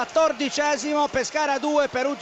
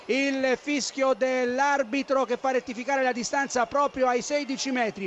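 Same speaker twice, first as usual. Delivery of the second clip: ordinary delivery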